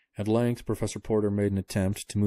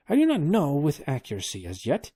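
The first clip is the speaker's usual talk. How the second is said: put-on pitched-up careful old scholar; high old professor voice; 7 semitones higher, 4 dB louder than usual. A narrator's reading. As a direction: answer like a cautious old professor. Professor unsure.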